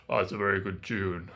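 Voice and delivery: deep, pleased giant. Acted. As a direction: rumble it out like a big giant. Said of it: "And the giant said".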